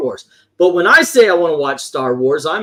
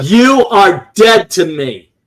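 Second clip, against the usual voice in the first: mock outrage; 3 semitones higher, 7 dB louder, slower than usual. Mock outrage at disliking classics.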